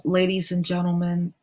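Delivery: ordinary talk, nothing marked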